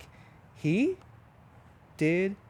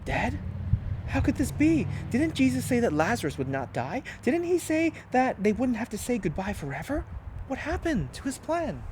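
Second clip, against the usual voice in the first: disciples in disbelief; 9 semitones higher than usual. A narrator's reading. Disciples shocked by the news.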